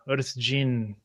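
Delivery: usual speaking voice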